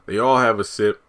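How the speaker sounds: normal talking voice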